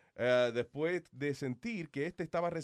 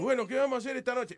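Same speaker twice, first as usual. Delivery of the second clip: Pope voice; 8 semitones higher, 4 dB louder than usual. Retold or imitated. Pope asking, voiced quote.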